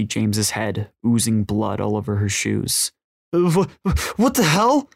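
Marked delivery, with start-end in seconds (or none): 3.3-4.9 stammer, then a high, louder cry; panicked, stuttering outburst; shocked scream. A narrator's reading. Jess seeing James shot, shocked high cry. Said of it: "Jess screamed".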